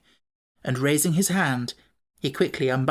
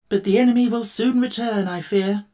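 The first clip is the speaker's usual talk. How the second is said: Tin Woodman voice; 7 semitones higher, 4 dB louder than usual. A narrator's reading.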